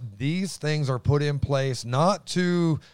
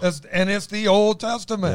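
high, loud burst of excitement; excited, booming; 8 semitones higher, 5 dB louder than usual. Real, not acted. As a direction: boom it out as excitement takes over. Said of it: "my voice booms".

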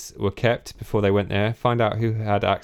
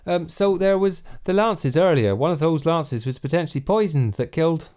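put-on West Country accent; 5 semitones higher than usual. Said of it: "my West Country accent".

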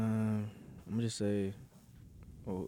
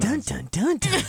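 dramatic suspense sting; 10 semitones higher, 10 dB louder, slower than usual. Acted.

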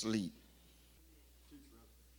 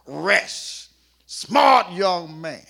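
slow, loud, emphatic approval; loud praise; 4 semitones higher, 12 dB louder, much slower than usual. Retold or imitated. Taylor praising the young man, loud and slow. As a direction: proclaim it slowly.

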